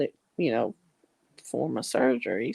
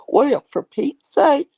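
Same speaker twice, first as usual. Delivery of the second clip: slow yawn, higher and louder; 9 semitones higher, 8 dB louder than usual. Real, not acted. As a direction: yawn while speaking. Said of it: "I'm gonna fall asleep on you".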